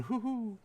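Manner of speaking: soft, high owl hoot. Acted. GM setting the night scene, imitated owl hoots. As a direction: imitate owls calling softly. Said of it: "a couple owls are going".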